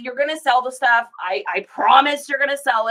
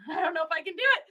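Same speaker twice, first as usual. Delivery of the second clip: giggling mumble; 5 semitones higher, 6 dB quieter, much faster than usual. Real, not acted. Can't face reading it.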